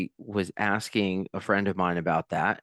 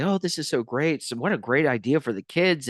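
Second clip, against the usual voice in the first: higher-pitched imitation of delighted visitors; gushing praise; 4 semitones higher than usual. Visitors praise the kids' play area to the manager, gushing. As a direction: lightly gush like an impressed fairgoer.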